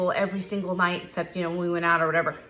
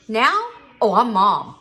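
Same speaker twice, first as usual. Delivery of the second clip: louder, emphatic claim; proud declaration; 7 dB louder, slower than usual. Real, not acted. Mother proudly declaring she has earned the title, louder. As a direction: announce it with pride.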